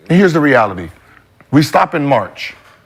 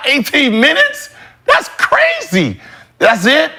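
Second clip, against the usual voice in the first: high-pitched, drawn-out disbelief; acted-out amazement, pitch shooting up; incredulous; 10 semitones higher, slower than usual. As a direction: scoff in a high, stretched-out voice. Acts out disbelief at the answer.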